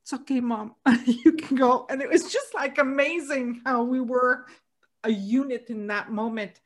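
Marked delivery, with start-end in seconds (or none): 0.0-1.9 gentle words that break into a laugh, louder at the end; reassurance with a laugh. Retold or imitated. Brother telling dying mom it's okay, laughing through it. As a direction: say it kindly, then laugh through it. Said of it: "he said".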